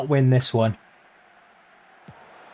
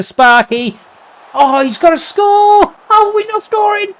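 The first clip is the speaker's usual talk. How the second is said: very high, loud cry of disbelief; disbelieving shout; 6 dB louder than usual. Real, not acted. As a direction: yell that he has to score. Chance missed again.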